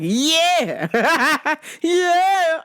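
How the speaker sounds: high, drawn-out lecherous yeah; hammed-up flirt breaking into laughter; mock leering. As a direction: squeal it with glee. Acted leering, laughing at himself.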